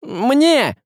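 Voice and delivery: high, sudden cry; urgent shout. A narrator's reading. Василий demands the knife, urgent high shout. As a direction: cry out to be handed it. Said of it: "закричал Василий".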